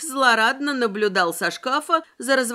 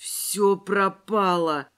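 slow, drawn-out dread; horrified; 3 semitones lower, much slower than usual. A narrator's reading. Воронов fears the neighbour will come, horrified. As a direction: utter it slowly, as if doomed. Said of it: "в ужасе проговорил Воронов".

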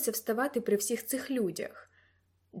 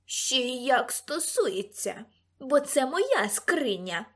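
slow, quavering protest; shaky, drawn-out outburst; trembling cry; 3 dB louder, slower than usual. A narrator's reading. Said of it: "крикнула тремтячим голосом тітка Медді".

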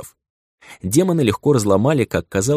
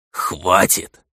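whisper yelling. Acted. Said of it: "сердито прошипел вампир".

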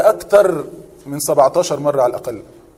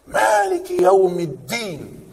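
slow, drawn-out recitation of the verse; Quran recitation; 3 dB quieter, much slower than usual. Shown as a demonstration. Teacher reciting a verse for the class, slow and drawn out. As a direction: stretch each word like a reciter.

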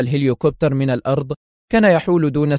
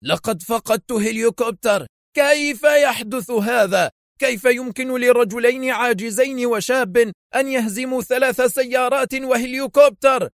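high-pitched outraged rant; furious, incredulous outburst, pitched high; angry shout; 10 semitones higher than usual. A narrator's reading. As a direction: rage at your subordinates in a high voice. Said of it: "وهو يصرخ في غضب".

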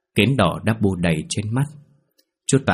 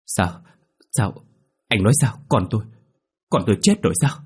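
on the verge of tears. Acted. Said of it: "mếu máo không tin".

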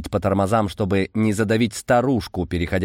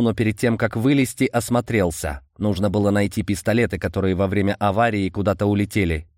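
plain reading voice

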